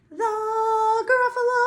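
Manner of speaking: high sung call; high loud Gruffalo. Shown as a demonstration. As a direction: belt the high note. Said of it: "Listen again".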